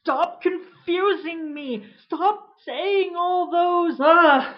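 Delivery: rising, cracking desperate plea; begging the voice to stop; panicked plea. Acted. Man pleading with the voice in his head, panicked.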